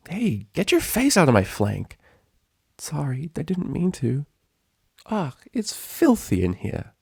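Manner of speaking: high, cramped bickering; squabbling ponies. Acted. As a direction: grumble in the tight closet.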